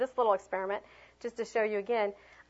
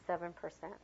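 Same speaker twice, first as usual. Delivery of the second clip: much softer, trailing monotone; flat and quiet; 4 semitones lower, 13 dB quieter than usual. Shown as a demonstration. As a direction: let it die away flatly. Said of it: "talk like this".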